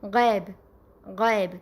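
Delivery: غیب with a flat e vowel, no glide; غیب read the Urdu way, not the Arabic way; Urdu-accented reading. Shown as a demonstration. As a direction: say غیب as gheb, Urdu style. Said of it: "Urdu لب و لہجے میں کس طرح پڑھتے ہیں".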